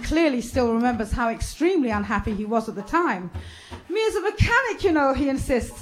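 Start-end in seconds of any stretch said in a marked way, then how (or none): 3.9-5.1 higher-pitched, light Jamaican patois quote. Acted. Family Man recalls his annoyance at the bust, in light Jamaican patois. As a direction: lightly voice a Jamaican musician recounting it.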